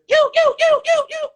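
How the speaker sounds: rapid string of loud, very high yelps; alarm call. Shown as a demonstration. Skater warning the floor that somebody falls, alarm-like yelps. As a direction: sound the alarm in piercing high bursts. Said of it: "It's like an alarm".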